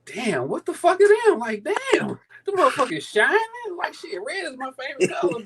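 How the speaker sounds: high-pitched excited gasp at the shoes; breathless high exclamation; amazed. Retold or imitated. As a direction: shoot way up in pitch, stunned and thrilled. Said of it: "I'm like".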